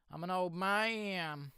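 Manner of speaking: high, slow put-on old man voice; mock old man. Playing an old man after saying it plain. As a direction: drag it out like a creaky old geezer.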